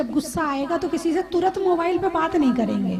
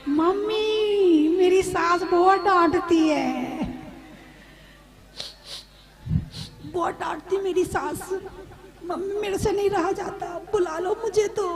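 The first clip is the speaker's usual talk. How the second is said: whiny daughter-in-law; 3 semitones higher than usual. Retold or imitated. New bride complains to her mother on phone, whining. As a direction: whine to mother like a homesick bride.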